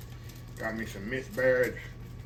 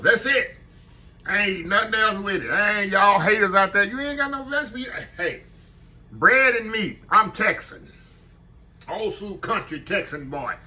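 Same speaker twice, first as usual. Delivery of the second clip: defiant boast; 8 semitones higher, 6 dB louder than usual. Real, not acted.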